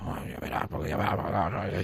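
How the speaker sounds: hushed half-whispered grumble, a mock sulk; grumbling angrily. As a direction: mutter it like a sulking youngster. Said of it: "estaba tan enfadado". (refunfuño enfadado en voz baja)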